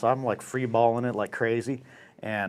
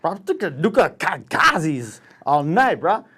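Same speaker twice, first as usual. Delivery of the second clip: put-on slow, tongue-tied drunk slur; hammed-up slurred stammer; drunken slurring; 4 semitones higher than usual. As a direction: stumble over the words, hammered. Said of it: "I couldn't even say nuclear kamikaze".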